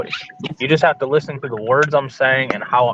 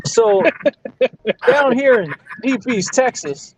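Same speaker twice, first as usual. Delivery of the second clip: talking like Ricky; 9 semitones higher than usual. Retold or imitated. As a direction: spin a down-home yarn as Ricky. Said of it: "that's all you gotta do to be Ricky".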